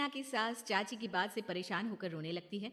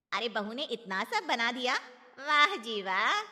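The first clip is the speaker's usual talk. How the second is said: loud praise; 9 dB louder than usual. Acted. Aunt praising loudly.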